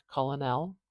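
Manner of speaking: "colonel" sounded out as COL-o-nel; supposed older pronunciation. Shown as a demonstration. Teacher guessing how it was said in the past, spelling pronunciation. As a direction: say "colonel" in three syllables, as it is spelt. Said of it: "probably pronounced more like colonel".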